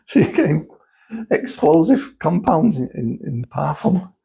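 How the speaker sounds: high-pitched, laughing disbelief; incredulous laughter. Real, not acted. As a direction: struggle to get it out while laughing. Laughing at explosives found in perfume.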